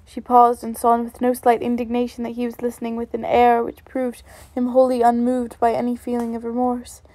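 ordinary delivery

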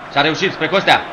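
live play-by-play swelling as the shot comes; excited commentary. Real, not acted. Commentating on a building attack.

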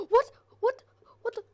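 sudden high, loud gasp of shock; startled. Real, not acted. Gamer spots something in the dark, startled. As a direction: jolt of real fright. Sudden scare in horror game.

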